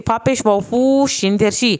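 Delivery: ordinary talk, nothing marked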